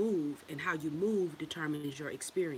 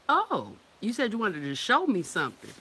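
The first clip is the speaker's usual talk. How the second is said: usual speaking voice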